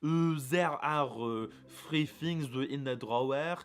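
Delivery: put-on slow English with a thick French accent; mock the French speaker, drawing out each word. Imitating how French people speak English, French accent. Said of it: "French people often speak American like this".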